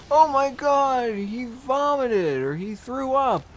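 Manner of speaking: high, drawn-out mock alarm; alarmed kid. Third grader seeing classmate throw up, mock alarm. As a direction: cry it out slowly like a shocked schoolkid. Child reacts to classmate vomiting.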